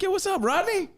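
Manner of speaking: very high, delighted "what's up"; excited greeting. Retold or imitated. Host greeting his old friend Rodney, very high excited. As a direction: greet an old buddy gleefully.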